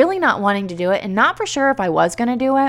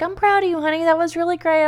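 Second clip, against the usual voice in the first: proud husband; 9 semitones higher, faster than usual. Retold or imitated. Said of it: "He's like".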